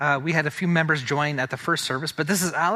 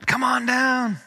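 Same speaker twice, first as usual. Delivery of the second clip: calling out; 10 semitones higher, 5 dB louder, slower than usual. Real, not acted. Calling Sarah to the front.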